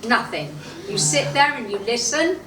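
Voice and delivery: ordinary talk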